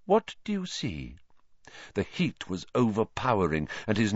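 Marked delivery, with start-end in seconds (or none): 0.0-1.2 high, airy mystic voice; misty seer voice. Acted. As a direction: float it like a dreamy seer.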